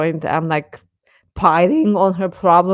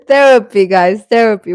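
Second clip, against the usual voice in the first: loud playful exclamation; 3 semitones higher, 9 dB louder than usual. Real, not acted. Streamer playfully announcing to chat that this is therapy, loud and excited.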